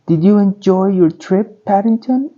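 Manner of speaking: put-on high girlish question rising at the end; Judy, young girl. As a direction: voice Judy as an eager little girl, pitched high. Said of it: "asked Judy".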